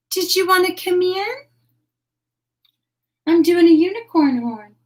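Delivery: very high, loud cooing to an animal; delighted, squeaky-high greeting; pet talk. Real, not acted. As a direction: gush in a sing-song voice to a beloved pet. Greets and coaxes her animal.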